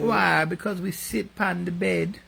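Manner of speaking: high, put-on Jamaican accent; hammed-up Jamaican accent, pitched well up. Joking answer to "Are you Jamaican?", mock Jamaican accent. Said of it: "That's my only Jamaican accent".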